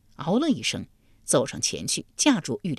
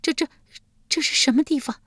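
high, halting, frightened woman; stuttering question in a raised voice; frightened stammer; 7 semitones higher than usual. A narrator's reading. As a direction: stammer it out, startled and terrified. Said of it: "结结巴巴道".

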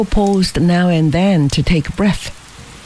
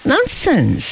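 joking; 9 semitones higher than usual. A narrator's reading. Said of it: "joked Fabian".

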